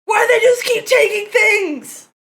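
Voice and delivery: high, wailing complaint; exasperated outrage. Real, not acted. Streamer protesting songs being taken, high outraged wail. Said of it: "I'm so mad!".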